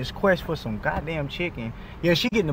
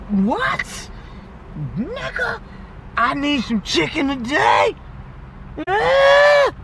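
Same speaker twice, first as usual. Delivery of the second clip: high, strained yell for chicken; high shouted outburst of hunger; frustrated shout; 10 semitones higher, much slower than usual. Real, not acted. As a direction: let the frustration burst out high. Frustrated outburst in Popeyes line.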